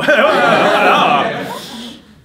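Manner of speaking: loud high burst of laughter; real hearty laughing. Real, not acted. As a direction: laugh out big. Laughing at own story.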